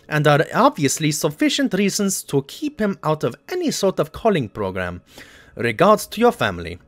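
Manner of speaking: hammed-up German accent reading a Nazi document; mock German accent. Gamer reads the in-game letter aloud in a mock German accent. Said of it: "speaking in a German accent".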